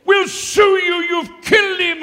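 high, loud furious yell; family yelling threats at the preacher. Retold or imitated. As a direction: scream the threat. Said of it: "they were yelling out".

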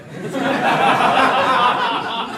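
real hearty laugh; loud burst of laughter. Real, not acted. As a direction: crack up at his own pirate bit. Laughs at own story.

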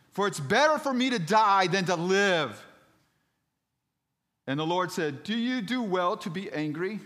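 high, shouted scripture reading; big theatrical pulpit delivery; impassioned preaching. Acted. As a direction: proclaim the dialogue at full force. Preaches Jonah's and the Lord's words.

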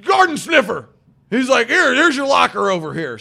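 high, loud hammed-up yell; mock yelling veteran. Veteran player yelling at the kid in the locker room, mock yell. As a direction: bark orders at the rookie.

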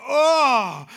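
loud retching "Oh" of disgust; disgusted groan. Retold or imitated. Pastor gagging on an onion at dinner, loud groan. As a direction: let out a gagging groan at the taste. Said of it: "like that".

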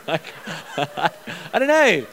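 laughing through the words; real laughter breaking in. Real, not acted. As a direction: giggle it out, embarrassed.

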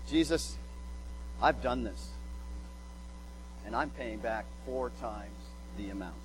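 quiet, humble owning up; sincere confession. Retold or imitated. Zacchaeus confessing to Jesus, quiet and sincere. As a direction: confess plainly and softly, no excuses. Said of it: "He's going".

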